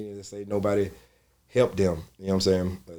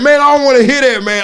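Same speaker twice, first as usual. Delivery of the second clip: much higher, louder comic protest; exasperated yell; 14 dB louder than usual. Retold or imitated. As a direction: refuse to hear the excuse. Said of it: "I was like".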